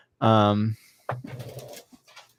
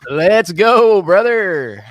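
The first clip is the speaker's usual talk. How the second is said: loud hype cheer; hype shout; 6 semitones higher, 10 dB louder, much slower than usual. Real, not acted. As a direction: shout it out, pumped. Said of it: "can we get a "Let's go"?".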